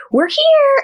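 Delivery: high chirpy voice reading the friend's text; bright text voice. Retold or imitated. Reading her friend's arrival text in a bright high voice. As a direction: chirp it way up, cheerful.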